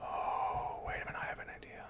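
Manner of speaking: sly scheming whisper. Real, not acted. Whispering his plan to himself while gaming, hushed. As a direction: hush it like a secret plan.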